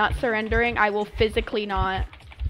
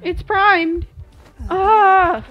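louder and higher, rising panic; panicked alarm; 7 semitones higher, 7 dB louder, much slower than usual. Real, not acted. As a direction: blurt it out in alarm. Panic at primed cipher machine.

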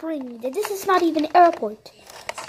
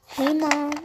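normal talking voice

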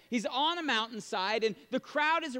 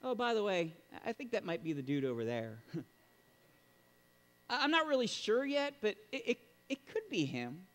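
offhand and unsure; 3 dB quieter than usual. Retold or imitated. Mock John pointing vaguely, offhand and soft. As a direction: shrug it off casually. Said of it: "No, he, he is emphatic".

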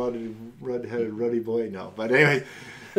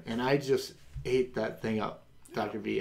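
usual speaking voice

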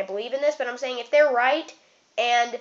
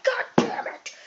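whispered curse; 11 semitones higher than usual. Retold or imitated. Retold frustration at waiting.

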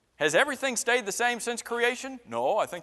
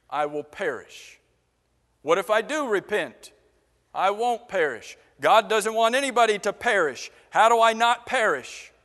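ordinary talk, nothing marked

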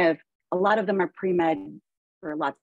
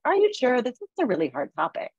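higher, coaxing "are you sure"; encouraging; 7 semitones higher, faster than usual. Retold or imitated. Lecturer to her silent class, gently encouraging. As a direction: coax shy students to speak up. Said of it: "to try to encourage them".

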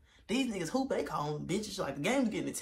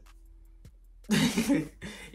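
laughing; 6 semitones higher, 8 dB louder than usual. Real, not acted. Laughing about girl's advice.

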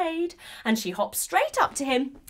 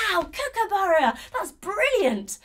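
excited; 8 semitones higher, 3 dB louder than usual. Real, not acted. Praising kids' song.

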